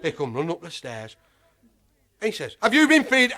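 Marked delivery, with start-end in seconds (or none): 2.6-3.3 angry accusation. Retold or imitated. Zoo keeper confronting him, acted.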